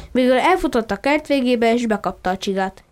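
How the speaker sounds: ordinary delivery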